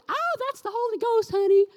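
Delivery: put-on high, loud laughing old-lady voice. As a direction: chuckle it out sweetly like a church grandma. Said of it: "She laughed".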